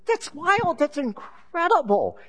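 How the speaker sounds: jaw-dropped disbelief, drawn out; flabbergasted. Acted. Man stunned by the talking dog, amazed. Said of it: "He's just flabbergasted".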